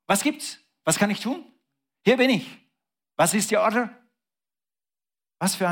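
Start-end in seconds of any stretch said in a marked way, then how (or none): 0.1-3.9 much higher, keen soldier reporting for duty; eager servant. Acted. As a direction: chirp it like an eager recruit. Abraham voiced as eager servant.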